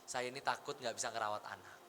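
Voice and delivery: hushed, low imitation of a tearful wife; almost whispered, retold admission of fear; retold tearful confession. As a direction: confide it very softly, without real sobbing.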